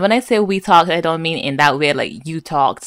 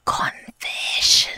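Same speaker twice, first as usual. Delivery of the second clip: soft dramatic whisper; 4 dB quieter, slower than usual. Real, not acted. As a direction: whisper it like a secret. Dramatic reveal of confession.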